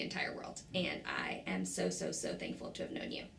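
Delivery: a choked-up whisper of thanks. Real, not acted. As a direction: let the voice drop to a breathy whisper. Tearful farewell to a close friend.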